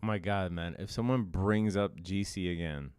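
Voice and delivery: usual speaking voice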